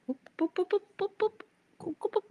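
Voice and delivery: very high squeaky boops; playful booping. Real, not acted. Booping the dog's nose, squeaky high boops.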